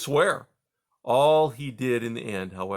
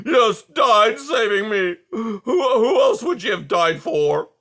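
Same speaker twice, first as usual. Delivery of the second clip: slow, loud, tearful cry, higher; 10 semitones higher, 10 dB louder, slower than usual. A narrator's reading. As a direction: wail it out in grief. Aerith grieving aloud.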